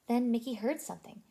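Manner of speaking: usual speaking voice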